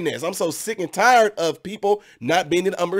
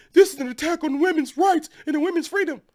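much higher, put-on protester voice; mock activist slogan; 10 semitones higher than usual. Vlogger mimicking pro-choice protesters, mock slogan voice. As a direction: chant it like a self-righteous activist. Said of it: "Call it what you want to".

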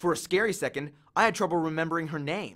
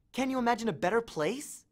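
put-on dreamy girl; 6 semitones higher than usual. A narrator's reading. Annabeth dreamy.

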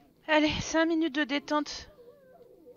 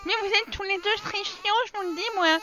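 normal talking voice

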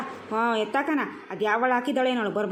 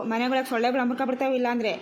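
ordinary talk, nothing marked

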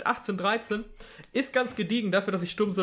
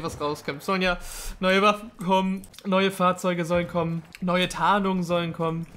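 ordinary delivery

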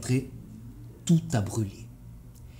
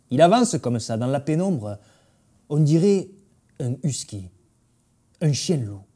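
plain reading voice

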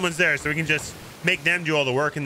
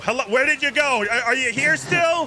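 high, hammed-up bewilderment; playfully frantic searching voice; mock alarm; 9 semitones higher than usual. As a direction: call out as if your teammate vanished. Pretends his sleeping teammate has vanished.

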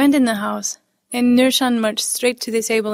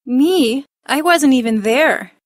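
higher, loud disbelief; indignant protest; 3 semitones higher, 6 dB louder, slower than usual. A narrator's reading. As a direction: protest your innocence.

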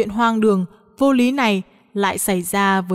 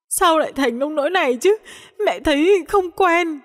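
higher, tearful whine; resentful sobbing; 8 semitones higher than usual. A narrator's reading. Mother still sobbing to daughter-in-law, higher tearful whine. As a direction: sulk through tears. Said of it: "Tôi vẫn ấm ức khóc".

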